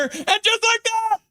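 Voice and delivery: very high shouted play-by-play; ecstatic championship call. Real, not acted. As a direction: scream the title call at the top of your pitch. Calls the championship-winning moment.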